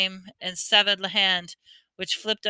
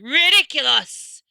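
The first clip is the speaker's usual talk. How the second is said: high, loud, slow shout; a determined boy hurling the spell; 6 semitones higher, 7 dB louder than usual. A narrator's reading. Neville charging at Snape, shouting determinedly. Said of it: "he shouted".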